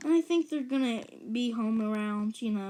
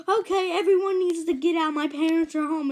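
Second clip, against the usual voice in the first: raised, louder urgent call; urgent warning; 6 semitones higher, 7 dB louder than usual. Retold or imitated. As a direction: order everyone out in alarm. Child alarms party guests.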